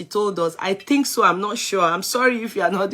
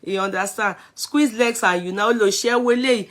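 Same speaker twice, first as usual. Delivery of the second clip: normal talking voice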